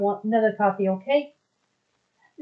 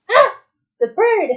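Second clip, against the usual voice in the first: sudden delighted outburst, higher and louder; excited exclamation; 8 semitones higher, 7 dB louder than usual. Real, not acted. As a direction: call out the bird like you just spotted it. Spots a bird in game.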